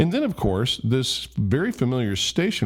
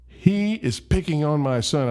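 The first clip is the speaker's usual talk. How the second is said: livid mom; 8 semitones higher than usual. Retold or imitated. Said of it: "is so livid".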